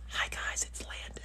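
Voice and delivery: soft ASMR whisper. Shown as a demonstration. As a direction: whisper the hello right into the mic. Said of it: "can you guys do some A S M R".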